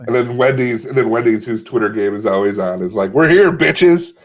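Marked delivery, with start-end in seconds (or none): 3.1-4.1 loud, high, drawn-out boast; brash announcement. Acted. Voicing Wendy's sassy Twitter account, brash announcement. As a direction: stretch it out loud and cocky.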